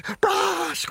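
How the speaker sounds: very high burst of a yell; wolf blasts it out as he flees; explosive high yell; roar. Acted. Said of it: "drønede ulven".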